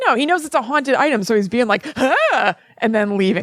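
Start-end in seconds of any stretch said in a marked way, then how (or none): 2.0-2.6 quick pretend fright noise; jokey scared yelp. Crew member facing a haunted doll, jokey scared yelp. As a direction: let out a mock startled "ah". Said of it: "like, jokey scared".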